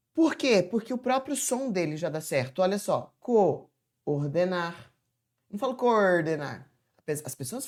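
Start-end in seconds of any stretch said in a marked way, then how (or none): none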